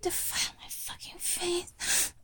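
hushed plea; whisper. Acted. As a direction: whisper the demand. Begging lover in roleplay.

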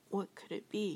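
plain reading voice

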